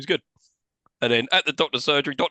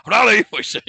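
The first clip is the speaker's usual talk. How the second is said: mock villain vet; 8 semitones higher, 6 dB louder than usual.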